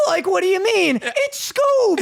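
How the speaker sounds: high, rising Shaggy whine. Acted. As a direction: squeak it out like Shaggy. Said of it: "Shaggy's just like".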